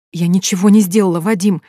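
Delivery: usual speaking voice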